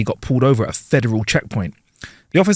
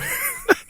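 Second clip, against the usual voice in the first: laughing; 7 dB quieter than usual. Real, not acted. Laughs at own joke.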